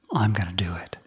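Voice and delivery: a sly whispered echo of the same line; whispered repeat. Acted. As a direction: say it again under your breath, unvoiced. Yaka insists on striking the monk.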